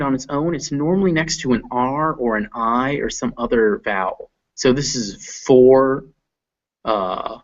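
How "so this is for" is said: for drawn out slowly. Shown as a demonstration. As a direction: stretch the or in for.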